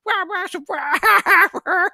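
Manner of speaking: high, loud mock "wah" noises; imitated crazy wailing. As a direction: babble wild wahs like a loony teammate. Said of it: "And he's like".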